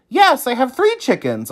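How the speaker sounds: reply like eager Oscar; Oscar answering. A narrator's reading. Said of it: "Oscar replied".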